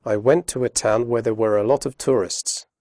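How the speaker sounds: fast natural speech with weak forms; quick connected read with schwas; read quickly. Shown as a demonstration. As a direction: run the words together quickly. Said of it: "Now listen to the same sentence read quickly".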